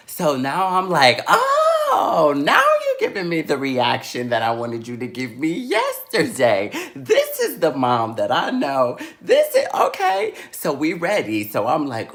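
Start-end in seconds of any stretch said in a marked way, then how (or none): 1.3-10.3 loud, swooping high delight; thrilled vindication. Retold or imitated. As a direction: crow it with hammed-up satisfaction. Child thrilled mother finally reacts.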